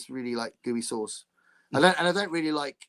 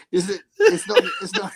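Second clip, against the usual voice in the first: high laughing splutter; half-laughing, can't get the words out; laughing disbelief; 8 semitones higher, 8 dB louder than usual. Real, not acted. Host cracking up at a saucy restaurant, laughing.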